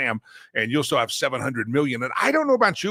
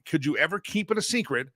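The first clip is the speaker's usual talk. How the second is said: ordinary talk, nothing marked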